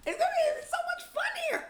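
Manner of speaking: very high, tiny squeal of a voice; squeaky mock voice. Mocking squeak after talk of getting rich. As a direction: squeak it out like a giddy cartoon.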